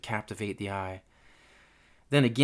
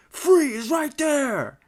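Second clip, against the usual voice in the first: high, loud, drawn-out command; burly man's shout; 6 dB louder, much slower than usual. Acted. Burly intruder bursting into the lab, shouting an order. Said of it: "shouted a burly man".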